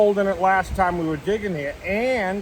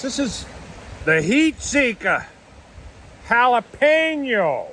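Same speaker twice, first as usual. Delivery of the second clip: product announcement; 6 semitones higher, slower than usual. Acted. Announcing the snack brand name.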